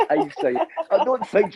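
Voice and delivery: high, slow words through laughter; laughing hard. Real, not acted. Telling friends about the fire-breathing stunt, laughing hard. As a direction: struggle to speak through real laughter.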